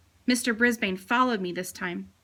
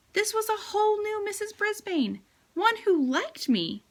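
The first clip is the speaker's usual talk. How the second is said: amazed; 11 semitones higher than usual. A narrator's reading. Narrator's delighted surprise.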